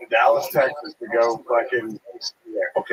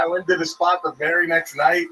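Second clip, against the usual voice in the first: ordinary talk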